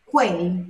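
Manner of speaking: quel said as kwel, the u sounded before the e; Italian quel. Shown as a demonstration. Teacher demonstrating Italian quel to students, kwel. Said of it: "quando tem o que, o E, é sempre pronunciado".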